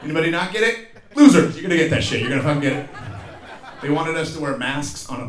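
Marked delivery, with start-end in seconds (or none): 1.2-2.9 loud, fast jeering at the crowd; mock taunt. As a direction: yell it like a schoolyard bully. Taunting the audience.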